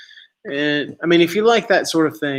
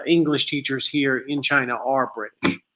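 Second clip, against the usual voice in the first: ordinary delivery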